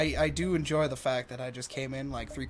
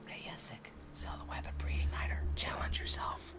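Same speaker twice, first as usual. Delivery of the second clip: conspiratorial whisper, much softer; 11 dB quieter than usual. Real, not acted. Friend whispering a mischievous dare to the player. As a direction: tempt her in a sneaky whisper. Sly aside urging a risky choice.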